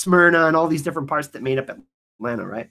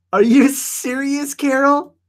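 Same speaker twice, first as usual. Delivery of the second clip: usual speaking voice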